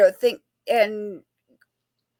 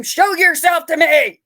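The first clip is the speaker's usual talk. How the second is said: higher, louder, slow demanding yell; mock ghost hunter yell; 7 semitones higher, 9 dB louder, slower than usual. Investigator mocking bad ghost hunters, hammed-up loud yell. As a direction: bark it at the empty room like a swaggering hunter. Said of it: "that sounded a lot like the guy in the too tight t-shirt".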